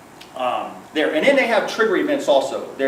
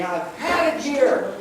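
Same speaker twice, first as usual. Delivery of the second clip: exploding; 8 semitones higher, slower than usual. Retold or imitated. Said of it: "kind of explode".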